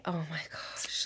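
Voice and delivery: barely audible, whispered aside; hushed, moved exclamation under the breath; overcome whisper. Real, not acted. Mother overcome recalling dying scene.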